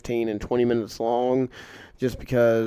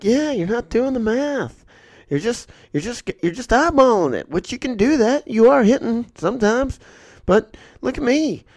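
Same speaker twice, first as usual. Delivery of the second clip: much higher voice, lecturing; mock old shooter; 11 semitones higher than usual. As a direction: show off like a smug veteran.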